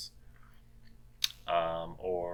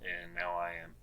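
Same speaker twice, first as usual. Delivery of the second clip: usual speaking voice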